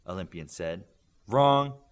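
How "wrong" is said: a loud, flat "wrong"; curt loud rejection. Acted. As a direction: shut the answer down hard. Coach shoots down answer.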